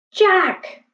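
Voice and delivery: high, calling call across the brush. A narrator's reading. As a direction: call his name to bring him back. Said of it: "Annie called".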